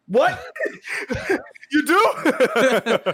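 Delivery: very high, loud disbelieving what with a laugh; delighted disbelief breaking into a laugh; overjoyed surprise. Retold or imitated. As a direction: squeal in happy shock. First time baby said love you.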